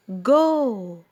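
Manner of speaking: "جو" held out long; long vowel. Shown as a demonstration. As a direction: draw out the vowel of "جو". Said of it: "أنا مديت". ("جو" ممدودة)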